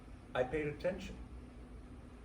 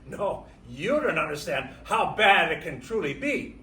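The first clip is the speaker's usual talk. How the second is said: louder, pressing the point home; emphatic retort; 10 dB louder than usual. Retold or imitated. Immigrant answering Americans who complain, raised and emphatic. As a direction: hit back firmly, voice raised. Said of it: "And I reply".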